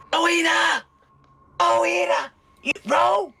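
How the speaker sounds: whisper yelling. Acted. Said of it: "he'll do that childlike whisper yell".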